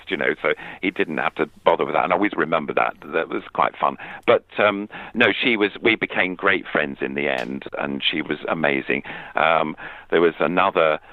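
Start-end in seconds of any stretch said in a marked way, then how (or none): none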